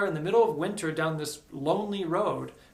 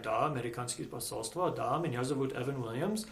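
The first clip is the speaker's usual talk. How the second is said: speaking Russian; 4 semitones lower, 6 dB quieter than usual. Retold or imitated. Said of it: "first I spoke in Russian".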